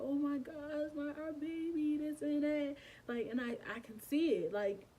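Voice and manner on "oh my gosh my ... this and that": imitated crying. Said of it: "I started crying".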